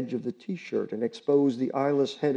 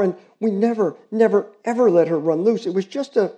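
put-on higher girlish plea, rising in volume; pleading girl; 7 semitones higher, 5 dB louder than usual. Girl begging the neighbors not to report her dog, urgent girlish plea. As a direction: beg them to spare the dog.

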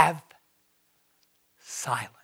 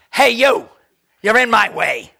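louder, higher mock scolding; mock picking a fight; 4 semitones higher, 6 dB louder than usual. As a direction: pick a fight, half in fun.

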